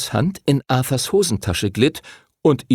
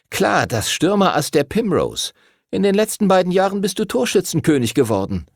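put-on higher, eager know-it-all boy voice; nerdy boy Arthur; 10 semitones higher than usual. A narrator's reading. Arthur the nerd introduces himself to Henry, high and eager. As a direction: lay out the numbers like a little know-it-all.

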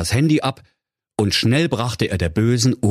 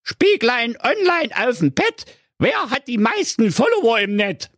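the vain stepmother. A narrator's reading. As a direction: coo the magic question like a vain queen. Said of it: "fragte die böse Stiefmutter".

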